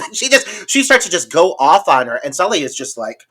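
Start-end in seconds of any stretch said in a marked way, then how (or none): none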